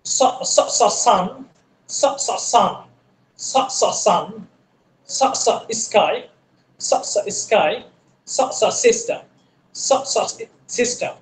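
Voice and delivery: s, s, সিস্টেম with a thin hissed s; thin s sound. Shown as a demonstration. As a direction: hiss a light, thin s from the tongue tip. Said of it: "এই স সাউন্ডটা একেবারে স".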